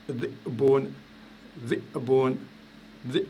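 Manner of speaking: slow dhi'bun, dhi cut off by a throat catch; dhi'bun broken by a silent gap after dhi; glottal stop demo. Shown as a demonstration. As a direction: say dhi, close the glottis, then bun in dhi'bun. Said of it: "je fais un coup de glotte".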